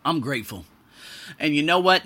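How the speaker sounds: ordinary delivery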